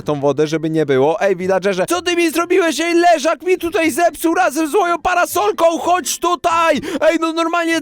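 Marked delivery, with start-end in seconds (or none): 1.9-7.8 yelling at the villager, pitched up; furious call across the village; angry shout. Real, not acted. Villager broke his deckchair.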